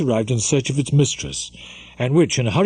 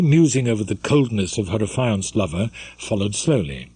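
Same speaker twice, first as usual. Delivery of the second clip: ordinary delivery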